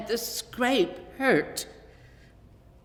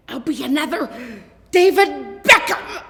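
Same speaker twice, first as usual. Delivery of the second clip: much higher and louder, soaring; triumphant boy; 7 semitones higher, 11 dB louder than usual. A narrator's reading. Boy imagining soccer glory, shouting with joy. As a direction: crow it like a champion.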